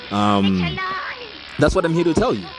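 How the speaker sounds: ordinary delivery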